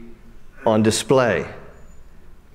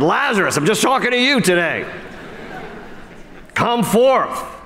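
high raised call, name first; 6 semitones higher than usual. Retold or imitated. Jesus singling out Lazarus at the tomb, high calling voice. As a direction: call one man out of the grave.

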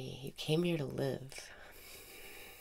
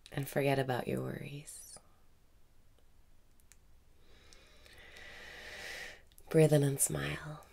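guided meditation. Acted. Meditation teacher guiding listeners through breathing, calm meditation voice.

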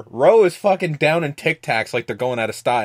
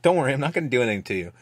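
rushed reassurance; 4 dB quieter, much faster than usual. Retold or imitated.

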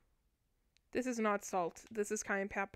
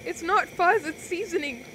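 a much higher, cracking voice, pleading; 6 semitones higher, 3 dB louder, slower than usual. Real, not acted. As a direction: let the voice crack up high while insisting. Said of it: "Why did my voice crack".

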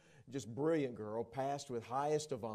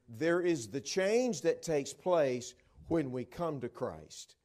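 preaching; 2 semitones higher, 6 dB louder, slower than usual. Real, not acted. Preaching at full force.

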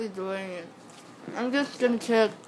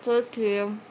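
cheeks stuffed full. Real, not acted. Marshmallows stuffed in mouth.

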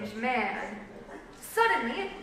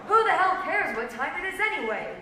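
higher voice, hit hard at the start; exasperated retort; 5 semitones higher, 4 dB louder, faster than usual. A narrator's reading. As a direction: snap back with mild exasperation.

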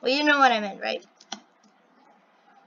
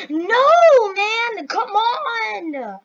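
high, drawn-out protest, loud at first; frustrated yell; 8 semitones higher, 6 dB louder, much slower than usual. Real, not acted. Player failing to complete the tower, frustrated yell. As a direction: yell and whine at the game. Fails against the timer.

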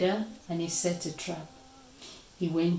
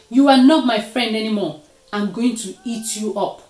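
angry threat; 3 semitones higher, 8 dB louder, faster than usual. A narrator's reading. Leopard threatens Deer.

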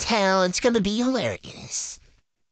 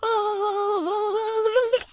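high delighted gasp of joy; excited squeal; 10 semitones higher than usual. Real, not acted. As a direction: let a thrilled little "oh" fly up high. Opening the shoes she ordered.

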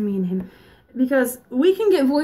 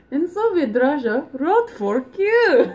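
put-on Russian accent; 5 semitones higher, slower than usual. Soviet Russia joke.

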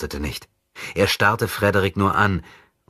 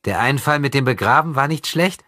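higher, louder sneering voice; mocking; 9 semitones higher, 4 dB louder than usual. Possessed boy sneering at his rescuer, mocking. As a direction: taunt him with a cold little compliment. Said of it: "sagte er spöttisch".

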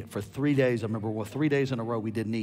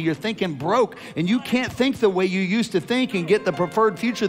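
higher and louder, building sermon cry; preaching at full force; 10 semitones higher, 7 dB louder than usual. Acted. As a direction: preach it hard to the crowd.

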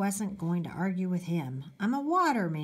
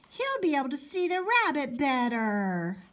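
put-on higher-pitched kid protest; little boy crying out; 6 semitones higher, 3 dB louder than usual. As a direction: protest like the smaller brother. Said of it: "Toby cried".

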